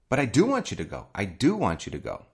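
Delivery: insistent, DO punched each time; emphatic DO against a wrong impression; stress on DO. Shown as a demonstration. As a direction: reassure firmly by leaning on "do". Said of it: "to emphasize that I really".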